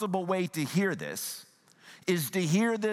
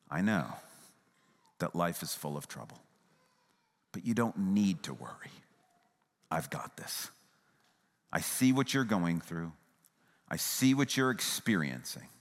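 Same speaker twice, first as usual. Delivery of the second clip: low, tender reassurance with long pauses; empathetic; 6 semitones lower than usual. Shown as a demonstration. Preacher as Jesus comforting the anxious, empathetic. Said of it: "the empathetic tone".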